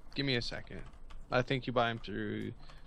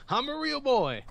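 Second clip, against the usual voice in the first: high, gleeful puppet voice; Pinocchio voice; 13 semitones higher, 6 dB louder than usual. Pretend. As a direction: squeal like a wooden boy come alive.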